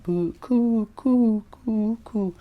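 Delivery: high mock cooing, like a dove; cooing baby sound. Shown as a demonstration. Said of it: "Cooed is the sound of, like a dove".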